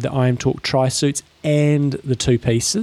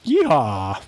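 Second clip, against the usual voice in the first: hammed-up Texas cowboy yeehaw; a drawn-out cowboy whoop in a Texas accent. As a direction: give a playful Texan yeehaw. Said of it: "Do, do, do, do your Texas accent".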